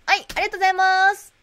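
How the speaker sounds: high, loud, quick shop thanks; fast cashier thank-you; clerk thanks. Acted. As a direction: rattle off a cheery thank-you.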